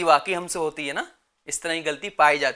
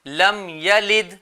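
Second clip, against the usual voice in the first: slow, measured tajweed recitation; Quran recitation; 4 dB louder, much slower than usual. Shown as a demonstration. Teacher models the verse for children, slow recitation. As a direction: recite slowly with drawn-out vowels.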